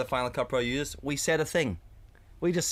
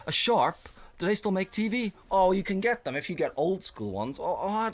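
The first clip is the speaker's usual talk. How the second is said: lightly voiced pub punter; 7 semitones higher than usual. Voicing the table's TV chatter.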